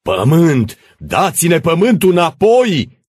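high, loud mob cry; shouted demand. Acted. Crowd to the administrator demanding land, shouting. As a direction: yell it, stretching the end.

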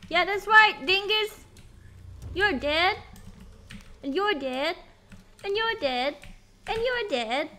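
loud, high gloating; triumphant gloating. Real, not acted. Streamer taunting the dead enemies loudly. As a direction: crow over each fallen enemy.

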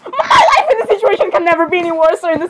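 high, dramatic wail of complaint; exasperated outcry. Acted. Speaker reacting to a disturbing drawing, exasperated outcry. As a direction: cry out as if life is ruined.

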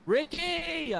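loud, high, falling shout of a name; calling out. Real, not acted. Player calls teammate left alone with the ghost, shouting out. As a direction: holler to someone in another room.

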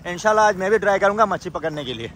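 usual speaking voice

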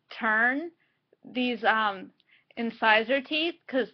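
speaking with fingers stretching lips; lips pulled with fingers. Real, not acted. Seen 3: Both hands pull lower lip down; one hand again 6.2-7.7.

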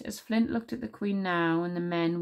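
ordinary delivery, nothing marked

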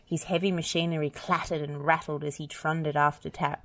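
ordinary delivery, nothing marked

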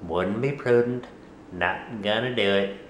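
low, halting presidential parody; Dana Carvey as George H. W. Bush. Acted. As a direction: do a comic's caricature of the president. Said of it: "I do an impersonation of Dana Carvey doing an impersonation of George".

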